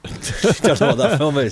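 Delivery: giggling it out; laughing at a silly film title. Real, not acted.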